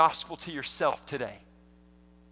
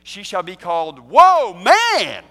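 "Woe Man" said as two slow words, WOE punched loud; slow, loud, stress "Woe" apart from "Man"; wordplay on Woe Man, slow and loud; split woe man; 11 dB louder, slower than usual. Shown as a demonstration. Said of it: "it works the same way in English".

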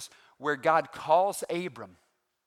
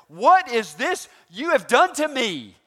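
slow, loud and high outraged demand; angry Pharaoh; 8 semitones higher, 9 dB louder, slower than usual. Acted. Pharaoh confronting Abram, slowly and loudly outraged. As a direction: roar it at Abram. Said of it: "he said it louder than that".

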